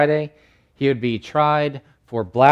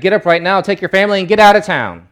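louder, higher urgent order; urgent command; 6 semitones higher, 6 dB louder, faster than usual. Acted. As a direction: sound the alarm, get them moving. Angel's urgent order acted out.

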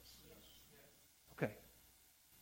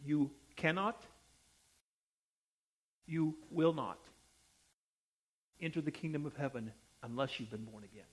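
lower, softer, earnest insistence; loving but emphatic; 6 semitones lower, 10 dB quieter than usual. Real, not acted. Preacher lovingly warning the congregation they must be born again, soft and emphatic. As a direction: say it gently, yet firmly and deliberately. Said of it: "I say this lovingly, but I say it emphatically".

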